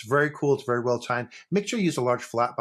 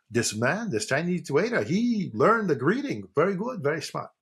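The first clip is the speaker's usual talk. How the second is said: put-on higher, lilting Yiddish accent; 5 semitones higher than usual. Schwartz marvelling at the Chinese waiter, in a Yiddish accent. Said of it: "I'm gonna do the fucking accent".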